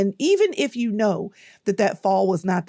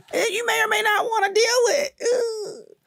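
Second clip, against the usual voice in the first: high squeaky exaggerated warning; mock dramatic squeal. Real, not acted. To viewers warning the book stirs things up, a high squeal. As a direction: squeal it like the book will hurt.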